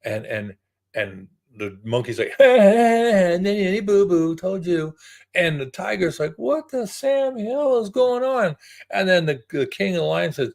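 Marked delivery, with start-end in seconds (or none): 2.4-4.9 put-on high, drawn-out childish taunt; sing-song jeer, slow and high; gloating monkey. Monkey taunting the tiger after winning, gloating. 6.4-8.5 high, drawn-out disbelief; baffled tiger. Acted. Tiger reacting to the lion's ruling, baffled.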